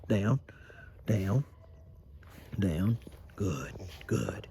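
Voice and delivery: usual speaking voice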